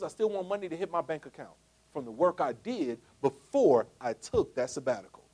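ordinary talk, nothing marked

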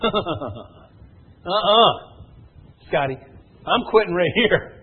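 high, loud complaining quitter; hammed-up quitter outburst; mock refusal. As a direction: protest like someone giving up. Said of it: "what most people say".

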